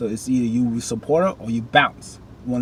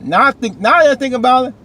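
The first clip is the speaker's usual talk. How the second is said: excited realization; 12 semitones higher, 8 dB louder than usual. Real, not acted.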